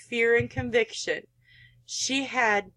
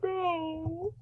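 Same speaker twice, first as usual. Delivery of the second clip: high, soft horrified no; can't bear to watch; stunned, high, soft refusal; 5 semitones higher, 6 dB quieter than usual. Real, not acted. Player stops the game in horror.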